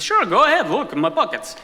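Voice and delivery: high, swooping, loud invitation; hammed-up willingness, pitch leaping; acted compliant stranger. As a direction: put on an over-willing high voice. Acted man inviting a search.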